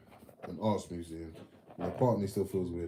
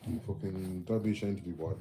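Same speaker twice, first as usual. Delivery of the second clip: ordinary delivery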